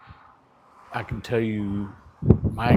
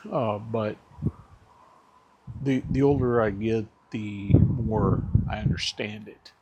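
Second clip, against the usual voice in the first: ordinary talk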